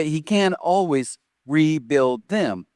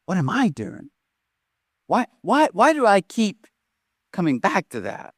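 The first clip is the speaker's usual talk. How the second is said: searching self-question; 3 semitones higher than usual. Acted. Preacher's self-questioning lament.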